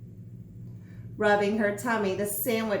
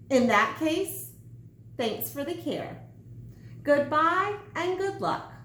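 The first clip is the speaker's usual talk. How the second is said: lightly voiced sandwich, smug and higher; bossy sandwich; 6 semitones higher than usual. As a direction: order him about with mock congratulations. Sandwich dismisses Pinkerton.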